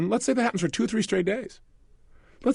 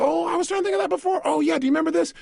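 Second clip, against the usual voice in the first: high-pitched, quick mock realization; sudden recollection; 11 semitones higher, faster than usual. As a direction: act out a memory popping up, pitch jumping high. Acting out a sudden memory.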